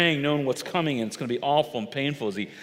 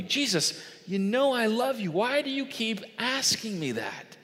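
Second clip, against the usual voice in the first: raised, wounded asking; hurt plea; 7 semitones higher than usual. Retold or imitated. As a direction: plead as one who is hurt. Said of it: "Peter was hurt".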